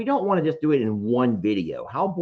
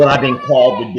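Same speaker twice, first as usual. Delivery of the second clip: loud, quick theatrical declaration; hammed-up echo; 11 dB louder, faster than usual. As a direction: belt it out along with the skit. Echoes his skit line over playback.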